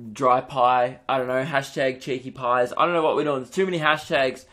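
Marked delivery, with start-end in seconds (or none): none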